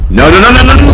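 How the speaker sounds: rising, loud repeated no; high, loud panicked no's; frantic protest. Real, not acted. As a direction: panic as he gets knocked down.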